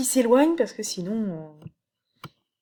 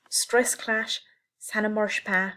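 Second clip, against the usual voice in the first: ordinary talk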